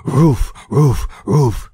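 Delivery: loud high barking yelps, woof woof; bear barking. A narrator's reading. A startled bear barking as it runs off. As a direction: bark out the words like a frightened bear fleeing. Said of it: "he answered".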